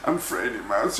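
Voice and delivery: ordinary delivery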